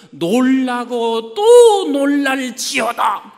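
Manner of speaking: loud, slow, drawn-out shout; proclaiming scripture. Acted. Preacher leads the congregation in the verse, loud proclamation. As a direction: boom out the verse at full force.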